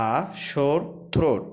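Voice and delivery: words stretched out slowly; slow dictation of a sore throat; very slow reading. Shown as a demonstration. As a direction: drag it out word by word. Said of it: "teacher reads sentence slowly".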